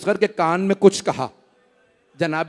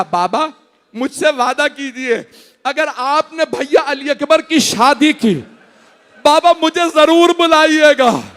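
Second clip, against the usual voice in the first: tearful plea; 7 semitones higher, 4 dB louder than usual. Acted. Sick daughter pleading with father.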